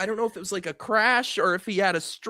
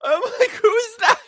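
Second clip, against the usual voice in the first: squeaking high through suppressed laughter; real laughing fit, pitch shooting up; helpless laughter; 5 dB louder than usual. Real, not acted. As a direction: get the question out while cracking up. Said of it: "I couldn't stop laughing".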